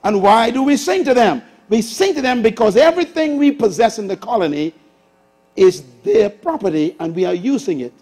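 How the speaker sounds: ordinary talk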